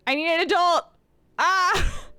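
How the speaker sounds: high, loud mock-panicked cry; hammed-up cry for rescue. Streamer begging for an adult, mock-panicked shout.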